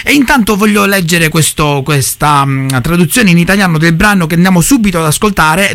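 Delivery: ordinary talk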